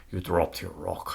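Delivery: quiet, slow retelling of "You dropped your rock."; movie line quote. Retold or imitated. Retelling the rock-throwing scene to her cohost, quiet and slow. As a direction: lightly act the big guy's line, no accent. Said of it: "And he says".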